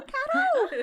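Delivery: shrill put-on female voice calling out; disguised woman's voice. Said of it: "Se eu falar com a minha voz, vai vazar". (irmão fingindo voz de mulher, bem aguda e alta)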